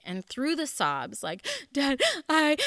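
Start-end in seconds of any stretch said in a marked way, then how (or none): 1.7-2.6 high, loud sobbing cry to her father. Retold or imitated. Daughter sobbing to her dad after the ordeal, high and loud. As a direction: choke out the word through tears. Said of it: "started to sob hysterically".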